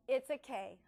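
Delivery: usual speaking voice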